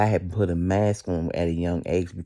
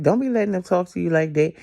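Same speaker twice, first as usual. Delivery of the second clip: high, mock scolding, pitched well up; scolding big sister; 11 semitones higher, 5 dB louder, faster than usual. Telling off her bullied friend, high and scolding. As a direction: wag a finger at the crying friend. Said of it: "And I'd be like".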